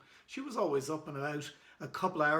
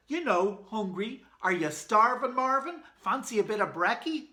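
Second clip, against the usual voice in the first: high, loud, bouncy coaxing; eager coaxing; 6 semitones higher, 6 dB louder than usual. Acted. Boy coaxing the caveman to eat, eager and loud. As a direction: sell him on breakfast.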